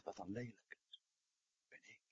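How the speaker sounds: fading almost to nothing; hushed confession. Retold or imitated. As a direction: let it drop away in shame. Woman's shocked self-realization.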